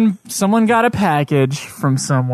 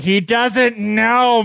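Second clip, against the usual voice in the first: slow and drawn out, know weighted; slow emphatic; 4 semitones higher, much slower than usual. Retold or imitated. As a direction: spell it out patiently. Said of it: "So the guy was like".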